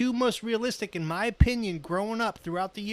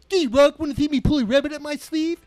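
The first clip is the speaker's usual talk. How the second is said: goofy cartoon moose voice, higher and louder; Bullwinkle; 7 semitones higher, 9 dB louder than usual. Recruit answering instructors, loud goofy cartoon moose voice. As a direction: ham up a dopey cartoon moose. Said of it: "good Bullwinkle impression".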